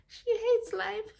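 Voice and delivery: slow, high, drawn-out exclamation; high-pitched repeat. Real, not acted. Reader on Josephine who hates life, high drawn-out exclamation. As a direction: stretch it out high, lifting at the end.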